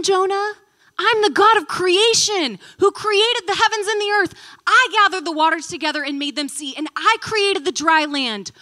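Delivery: high, loud, forceful divine reminder; God declaring his power to Jonah, booming. Retold or imitated. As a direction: thunder it like the Creator reminding Jonah. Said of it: "he's saying".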